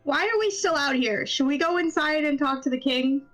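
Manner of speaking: ordinary talk, nothing marked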